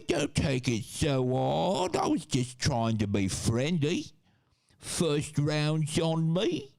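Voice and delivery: hammed-up high-pitched British pub bloke; mock British mate, pitch climbing on each phrase; mudfish British. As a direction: overdo a slow, whining-up British accent. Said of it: "high British and mudfish British".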